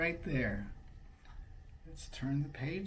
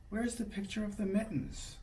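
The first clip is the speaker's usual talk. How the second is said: plain reading voice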